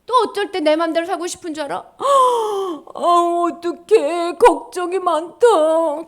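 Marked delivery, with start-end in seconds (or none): 1.8-2.9 loud sudden gasp of alarm; sharp gasp. Acted. Acting worried child. 3.0-6.0 loud, fretting worry, last words slowed; worried fretting. Acted. Acting worried child.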